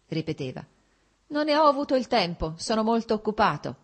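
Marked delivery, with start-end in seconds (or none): 1.3-3.8 short and dry, brushing off the invitation; curt reply. A narrator's reading. Alexei curtly brushing off his brother-in-law, dry and short. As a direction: clip it off, no warmth, a touch higher. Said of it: "rispose secco".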